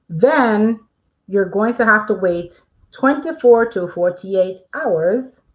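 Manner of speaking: drawled-out accidental accent, slow; a slow accidental accent on the wait time; slipped into an accent. Real, not acted. Host to viewers, slipping into a slow put-on accent. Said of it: "Don't know why I said that with an accent".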